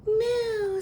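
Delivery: high playful moo; cow moo. A narrator's reading. Reading the cow's line, a high moo. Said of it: "said the cow".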